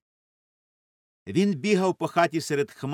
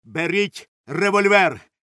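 loud, slow command; raised, emphatic cry; shouting; 5 semitones higher, 7 dB louder, slower than usual. A narrator's reading. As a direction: shout an urgent order. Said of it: "кричав він".

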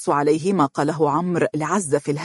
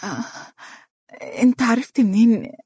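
hoarse voice; 6 semitones higher than usual. A narrator's reading. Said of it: "بصوت مبحوح".

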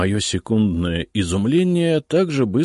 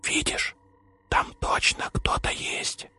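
slow tense whisper; 6 dB quieter, slower than usual. A narrator's reading. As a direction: whisper it, afraid to be heard. Hushed warning while sneaking.

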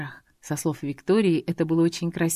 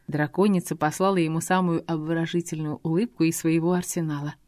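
ordinary delivery, nothing marked

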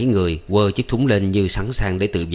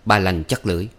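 plain reading voice

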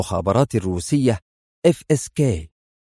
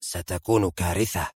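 softer, low murmur to himself; muttered; 5 semitones lower, 7 dB quieter than usual. A narrator's reading. Raoul sinks back in his seat, muttering. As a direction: let it sink in as you sit back. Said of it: "تراجع راؤول في مقعده مغمغما".

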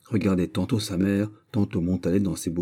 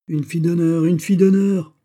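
rising to a much higher, louder cry; scandalized outcry; 10 semitones higher, 7 dB louder than usual. Acted. Old lady cries out to heaven, building scandalized outcry. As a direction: throw up your hands in outrage. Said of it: "s'écria la vieille dame en levant les mains au ciel".

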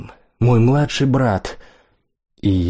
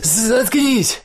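high-pitched cry, voice cracking; cracking shout; 13 semitones higher than usual. A narrator's reading. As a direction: yell it so the voice breaks up high. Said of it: "закричал Лиам срывающимся голосом".

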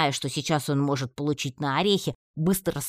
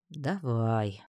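drawn-out, dejected agreement; mildly mournful sword's voice; glum; 3 semitones lower, 3 dB quieter than usual. A narrator's reading. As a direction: agree with a weary lack of will. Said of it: "уныло согласился меч".

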